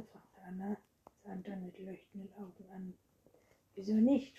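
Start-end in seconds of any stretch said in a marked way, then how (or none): 3.8-4.4 startled, much louder question; surprised. Acted. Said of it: "fragte er überrascht". (überrascht und deutlich lauter gefragt)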